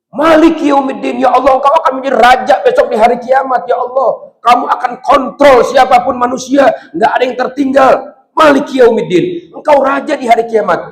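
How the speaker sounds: high, loud sermon build; full-force preacher; fiery preaching. Acted. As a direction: preach it hard to the crowd. Proclaiming God as king.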